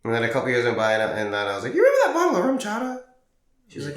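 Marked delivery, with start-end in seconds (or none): 1.7-3.0 much higher, louder and fast, sing-song; mock innocent question. Years later, teasing mom about the rum bottle, high and bright. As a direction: chirp it up high like it's nothing.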